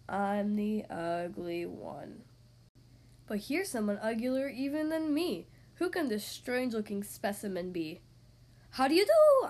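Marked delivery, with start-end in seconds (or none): 0.1-2.2 lower, slow, dragged out; slow sung-like refrain; slow drawn-out refrain. Acted. Slowed repeat of refrain. 8.7-9.5 much higher and louder greeting; loud high hyena hello; bright hyena greeting. Acted. Hyena introduces itself.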